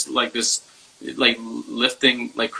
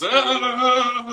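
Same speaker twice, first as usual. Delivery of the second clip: put-on high, frantic little kid's yelps; panicking little kid; 11 semitones higher than usual. His inner child panicking, high frantic yelps. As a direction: yelp like a scared small child freaking out. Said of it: "It's like this little kid, a version of me".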